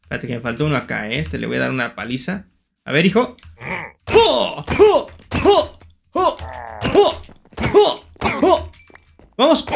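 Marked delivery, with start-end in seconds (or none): 4.1-8.7 short, high fighting yells; attack yelps. Pretend. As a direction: punch out a high "hoh" with each blow. Attack noises while fighting in game. (gritos cortos de ataque)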